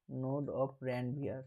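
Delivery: node of Ranvier stretched out very slowly, word by word; slow drawn-out term. Shown as a demonstration. Teacher to students, naming the neuron gap: very slow. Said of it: "teacher drawing out the term".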